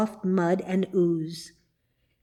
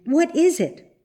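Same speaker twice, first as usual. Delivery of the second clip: alarmed; 7 semitones higher than usual. A narrator's reading. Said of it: "cried Marjorie".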